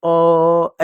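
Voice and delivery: अ held long and level; sustained अ. Shown as a demonstration. Teacher showing the sound left after the consonant, drawn-out अ. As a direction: draw अ out like the end of ख. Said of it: "ऐसा आवाज सुनाई देगा".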